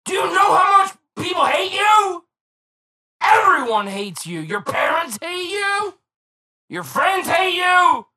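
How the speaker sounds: loud, high, furious shouting; angry rant. Acted. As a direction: yell it in mock outrage. Comic rant about Android users.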